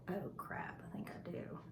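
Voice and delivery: hushed, breathy "oh crap" to herself; sudden whispered dread; panicked whisper. Retold or imitated. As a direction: whisper it like you just got caught. Panicked aside when daughter says she knows.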